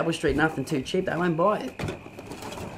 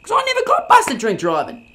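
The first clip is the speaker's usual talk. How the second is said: angry denial; 7 semitones higher, 6 dB louder than usual. Acted.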